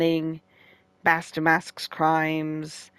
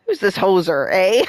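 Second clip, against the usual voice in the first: mock Canadian accent, rising on eh; hammed-up Canadian accent; a light Canadian accent for the detective; 3 semitones higher, 5 dB louder than usual. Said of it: "this supposed to be Canadian?".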